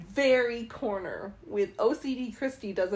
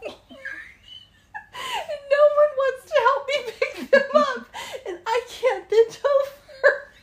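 laughing; 13 semitones higher, 6 dB louder than usual. Real, not acted. Laughing helplessly while retelling coin mishap.

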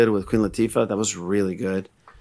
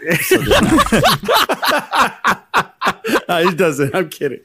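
laughing; much slower than usual. Real, not acted. Laughing at his own bit.

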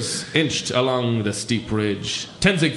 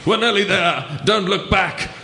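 high, louder, breathless push; panting urgency; 8 semitones higher, 4 dB louder than usual. Acted. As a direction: drive him on, high and loud. Frantic climb to summit.

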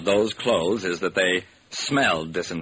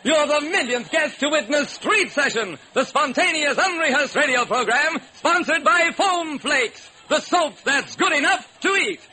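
radio announcer. Acted. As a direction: hype the crowd. Host reads sponsor plug.

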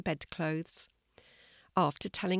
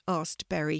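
usual speaking voice

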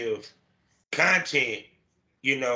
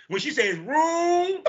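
much higher, slow, laughing Baltimore "room"; imitating Baltimore accent; 13 semitones higher, much slower than usual. Host mimicking guest's Baltimore "room", laughing. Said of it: "I love the Baltimore accent".

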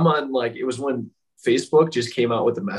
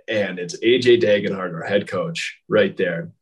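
ordinary talk, nothing marked

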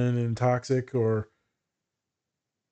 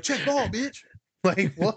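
tough baller; 6 semitones higher, slower than usual. Retold or imitated. Mock basketball trash talk.

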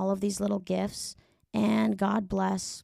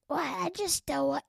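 higher, halting actress caricature; Natasha Lyonne; 6 semitones higher than usual. Acted. Comic imitating the Poker Face star, higher put-on voice. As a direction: stammer in a put-on, baffled voice. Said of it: "Natasha Lyonne".

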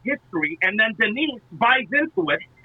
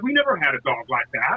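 imitating dad; 8 semitones lower than usual. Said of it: "and he was like".

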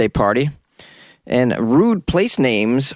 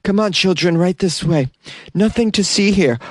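chaperone voice; 5 semitones higher than usual. Pretend. Guest ushering children away.

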